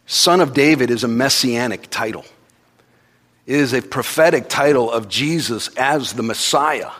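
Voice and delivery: normal talking voice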